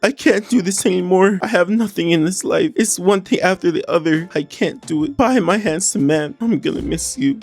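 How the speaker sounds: high, pleading, apologetic reading of the texts; voicing a distraught Huda. Narrating Huda's texts to Louis, high and pleading. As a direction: read it like a tearful goodbye. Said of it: "Huda starts us off saying".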